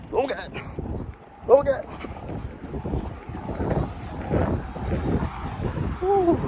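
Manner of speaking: rising frightened cries of oh God; panicked squeals while dodging traffic; scared gasps. Real, not acted. Crossing a busy highway on foot.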